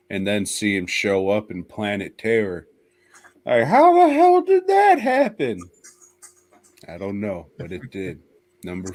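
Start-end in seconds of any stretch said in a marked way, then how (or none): 3.5-5.6 much higher, louder incredulous yelp; mock disbelief. Host mocks the movie's plot hole, in loud disbelief. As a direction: squeal it in fake outrage.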